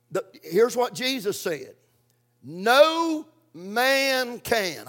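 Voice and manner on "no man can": drawn out very slowly, each word weighted; slow emphatic recitation. Shown as a demonstration. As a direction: spell it out slowly for the congregation to repeat. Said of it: "I want everybody to say that".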